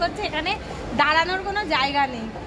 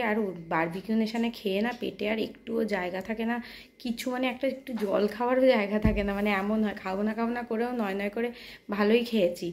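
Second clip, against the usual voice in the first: speaking softly; 6 semitones lower, 6 dB quieter than usual. Real, not acted. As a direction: keep your voice down, soft and low. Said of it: "আমার গলাটা আমি আস্তে আস্তে করে কথা বলছি".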